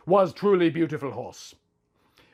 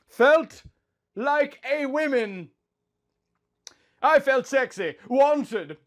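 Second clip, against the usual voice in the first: higher, slow and breathy-swept reading; swooning passion; 7 semitones higher, slower than usual. A narrator's reading. Narrator reliving the night with Link, rapturous. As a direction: let it climb slowly into rapture. Passionate climax of love scene.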